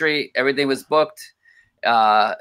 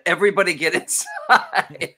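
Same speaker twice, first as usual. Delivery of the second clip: laughing call; 4 semitones higher than usual. Retold or imitated. Calling kids inside, laughing.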